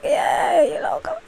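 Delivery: high, hushed come-hither squeak; talking cannolis. Pretend. Cannolis calling to the one who wants them, playful high voice. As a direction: coax softly in a tiny high voice. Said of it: "the cannolis was like".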